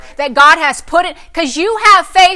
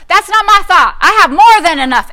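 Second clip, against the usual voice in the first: louder and higher, answering the devil; loud rebuke; 5 semitones higher, 9 dB louder than usual. Shown as a demonstration. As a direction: shout back at the devil. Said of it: "the devil comes with the wrong report".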